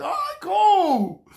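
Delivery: high and slow, savouring it; pleased. Retold or imitated. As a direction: stretch it out, delighted. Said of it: "I was happy".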